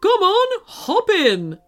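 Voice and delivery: urgent teddy call, starting high; urging. A narrator's reading. Teddy Marshall calls the children into the car, urging. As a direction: hurry them along in character. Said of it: "urged Marshall".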